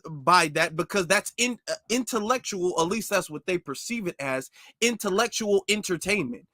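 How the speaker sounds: ordinary delivery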